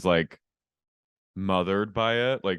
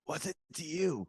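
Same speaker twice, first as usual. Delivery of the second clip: soft, high-pitched put-on voice; mock retort; 6 semitones higher, 11 dB quieter than usual. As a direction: toss back a small, high imitation retort. Acted retort in the retold fight.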